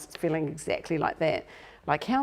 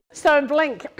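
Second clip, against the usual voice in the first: laughing; 11 semitones higher, 4 dB louder, slower than usual. Real, not acted. Laughing after joke.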